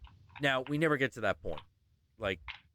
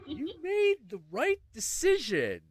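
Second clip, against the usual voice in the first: high, emphatic "you made the right decision"; lightly voiced exes agreeing; relieved ex-boyfriend; 8 semitones higher than usual. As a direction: reassure him like a survivor.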